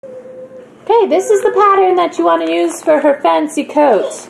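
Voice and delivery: booming, very loud and high. Acted. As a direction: announce it across the room. Loud shouted sewing tip.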